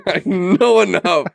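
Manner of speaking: high, slow mocking echo through laughter; laughing mimicry. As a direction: parrot it back, laughing, drawn out. Laughing mimicry of co-host's outburst.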